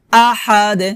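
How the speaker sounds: "আহাদ" with pressed h and bounced final d; qalqalah with throat h. Shown as a demonstration. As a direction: press the h in "আহাদ", let the d echo as "de". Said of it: "কলকলা, কলকলা হবে লাস্টে".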